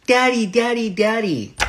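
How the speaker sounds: put-on high, excited little-girl call; two-year-old daughter. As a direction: imitate a toddler calling for her dad. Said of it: "two-year-old girl".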